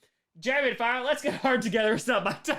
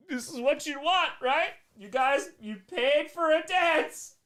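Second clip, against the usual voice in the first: higher, louder teasing come-on; hammed-up seductive taunt; mock seductive tease; 7 semitones higher, 4 dB louder than usual. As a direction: play the stripper teasing the audience. Mock stripper tease to chat.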